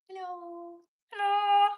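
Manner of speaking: high, sing-song hello rising to a loud squeal; cutesy high greeting; squeaky hello. Pretend. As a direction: chirp hello like a cartoon. Playful repeated hello.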